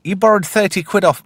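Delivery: high, loud mock nag; mum complaining. Mother complaining about borrowed money, high nag. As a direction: nag him like an annoyed mother. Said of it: "And she's like".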